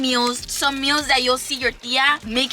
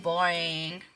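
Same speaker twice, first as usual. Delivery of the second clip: bored; 5 semitones lower, 7 dB quieter than usual. Retold or imitated. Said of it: "for those who are like".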